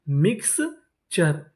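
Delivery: Mixture slowly split into mix and चर; syllable by syllable. Shown as a demonstration. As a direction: sound out mixture in separate parts. Teacher sounding out new word.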